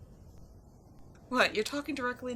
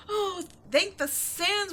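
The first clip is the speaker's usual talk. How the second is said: relieved; 8 semitones higher, 5 dB louder, slower than usual. Acted. Relief at leaving the temple.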